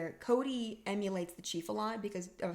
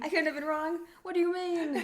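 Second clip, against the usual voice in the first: incredulous; 10 semitones higher, 5 dB louder than usual. Retold or imitated. Re-quoting Huxley, incredulous.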